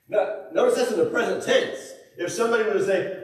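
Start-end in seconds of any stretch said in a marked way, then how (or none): none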